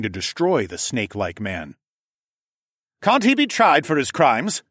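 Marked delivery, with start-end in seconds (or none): 3.0-4.6 higher, louder call over the din; shouted over chaos. A narrator's reading. Horace calling out over the chaos of the court, shouting. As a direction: shout to be heard over a crowded hall. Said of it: "Horace shouted over the chaos".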